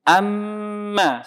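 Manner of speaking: Amma with a long nasal hum on the doubled m; ghunnah demo. Shown as a demonstration. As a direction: hold the mm in Amma, humming through the nose.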